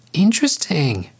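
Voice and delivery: high, airy call to the sky; high-pitched lioness calling out. A narrator's reading. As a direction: call it out high, as if to nobody in particular. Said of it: "Jasmine called out to the evening sky".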